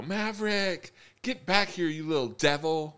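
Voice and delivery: high, loud mock scream at a runaway kid; imitated screaming mom. Mom half out of breath chasing her toddler, screaming imitation. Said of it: "screaming down the aisle".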